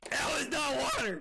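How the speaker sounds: loud, high shocked protest; choked outcry. Real, not acted. Streamer protesting it wasn't water, loud shocked outcry. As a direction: shout it between coughs. Drank something not water.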